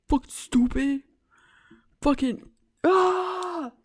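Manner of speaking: loud, high exasperated cursing; comic frustrated yell; frustrated outburst. Real, not acted. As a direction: blurt out in frustration.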